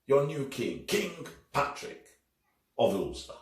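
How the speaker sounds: high, loud herald's cry; ringing royal announcement; royal proclamation. Acted. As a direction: proclaim the new king to the crowd. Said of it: "The king declares".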